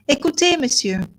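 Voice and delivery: usual speaking voice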